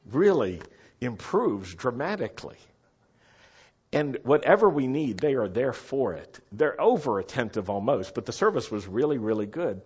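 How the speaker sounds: normal talking voice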